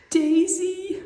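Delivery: whispered and high, imitating a crying child; tearful whisper. Mom retelling her crying daughter waking her, in a high whisper. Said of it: "she's in tears and she's crying".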